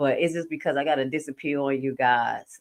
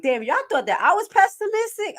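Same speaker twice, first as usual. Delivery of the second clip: mock family voice; 4 dB louder than usual. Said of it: "They'll be like".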